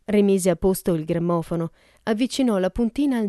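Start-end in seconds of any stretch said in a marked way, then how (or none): none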